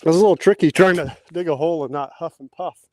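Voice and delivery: breathless from digging; puffing between words; out of breath. Real, not acted. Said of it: "'cause I'm out of breath".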